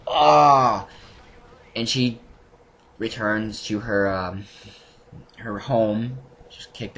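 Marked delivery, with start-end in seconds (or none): 0.1-0.9 loud, higher anguished cry. Retold or imitated. Fan hearing Lucy's father died, a loud anguished cry. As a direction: let out a pained gasp of shock. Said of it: "I was like".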